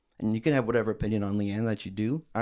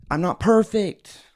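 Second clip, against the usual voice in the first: high loud drawn-out mock yell; 9 semitones higher, 7 dB louder, slower than usual. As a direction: yell it slowly like a bratty teen. Mocking her shouting at mom.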